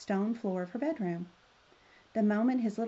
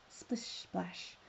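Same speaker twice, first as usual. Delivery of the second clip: soft, whispered splish-splash; near-voiceless splashy sound effect; 9 dB quieter than usual. A narrator's reading. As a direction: hush the wet footsteps right down. Imitates muddy footsteps.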